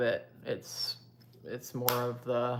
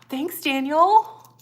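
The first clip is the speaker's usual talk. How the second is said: much higher, louder gasped thanks; delighted thanks; 13 semitones higher, 10 dB louder, much slower than usual. Real, not acted. Creator delighted as a viewer named Daniel gives support. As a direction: gasp and thank him brightly.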